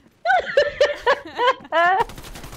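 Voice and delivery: high cartoon witch cackle. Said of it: "like a cartoon witch".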